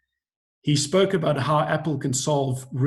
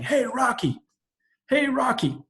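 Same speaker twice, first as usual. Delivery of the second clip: a fan's mocking shout from the stands; heckler shouting; 9 semitones higher than usual. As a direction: yell at Rocky from the grandstand. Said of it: "he keeps shouting".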